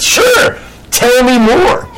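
squeaky high mock-eager sure; interviewer. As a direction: play the polite interviewer. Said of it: "The interviewer says".

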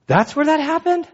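high, sudden shock; startled disbelief. Retold or imitated. Pastor hearing an old friend's story, high and startled. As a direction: caught off guard by the memory. Pastor learns of a wild night.

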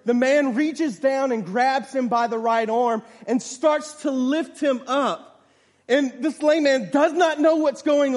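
loud, high, building sermon storytelling; full-force preaching. A narrator's reading. Preacher telling a congregation of a lame man lifted up, full-force preaching. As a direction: drive the story upward, loud and emphatic.

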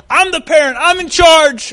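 loud, high mock insistence; hammed-up parental outburst; exasperated parent. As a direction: shout it like a parent losing control. Acts exasperated parent asserting control.

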